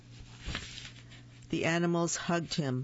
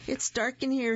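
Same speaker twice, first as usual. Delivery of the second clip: high little raccoon voice; 8 semitones higher than usual. Acted. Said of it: "he said".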